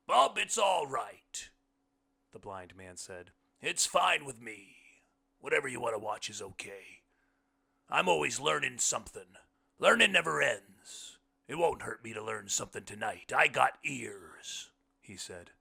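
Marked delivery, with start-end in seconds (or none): 0.1-1.5 very loud, high, drawn-out character voice; booming, slow reassurance from the guest; hearty blind man. A narrator's reading. Blind man's line in story. 3.6-14.7 hearty blind man. A narrator's reading. Blind man's speech in story.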